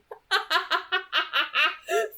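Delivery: high amused laugh. Real, not acted. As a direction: crack up at the line. Laughs at man up line.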